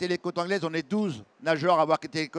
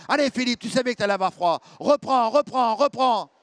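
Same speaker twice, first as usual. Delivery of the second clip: louder, urging shouts; team yelling encouragement; 4 semitones higher, 4 dB louder than usual. Retold or imitated. Team in the boat urging the tired swimmer, loud. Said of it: "mon équipe me crie dessus".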